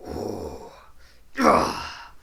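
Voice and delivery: low ooh into a breathy exhale; relieved sigh. Acted. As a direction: let out a big breath of relief. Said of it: "looks really relieved".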